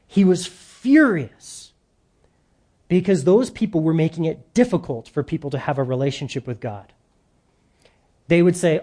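ordinary talk

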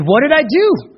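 a caught man's shocked demand; stunned demand. Retold or imitated. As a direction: ask it as if the ground just dropped away. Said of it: "Stunned, the man demi-, demanded".

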